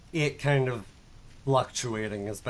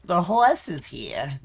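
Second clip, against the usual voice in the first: higher, louder, slow silly announcement; cartoon quote voice; 4 semitones higher, 5 dB louder, slower than usual. Streamer quoting a cartoon in a silly voice.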